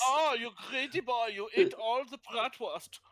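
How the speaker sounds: high, loud hammed-up German accent. Player hams a cartoon German accent over bratwurst. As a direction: play a cartoon German scolding. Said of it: "Is that what German sounds to you like".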